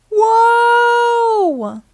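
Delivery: high, loud held yell; long sliding "whoa". A narrator's reading. Dad sliding helplessly across the floor, long yell. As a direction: holler it out as if skidding away.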